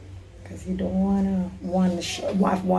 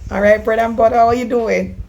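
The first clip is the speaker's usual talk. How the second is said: louder and higher, pushing the voice; talking loud; 3 semitones higher, 9 dB louder than usual. Real, not acted. Talking louder to a caller named bread and butter, strained loud. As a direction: push the words out loud to be heard.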